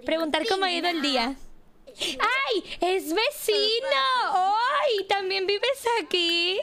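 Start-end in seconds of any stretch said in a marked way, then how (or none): 1.9-6.6 high, slow gushing surprise; hammed-up delighted squeal. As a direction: stretch it out in mock amazement. Feigned surprise meeting neighbour.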